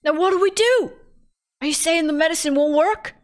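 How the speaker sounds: overdone British worry; alarmed captor asks what to do, bad British accent. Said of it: "I gotta do a different accent".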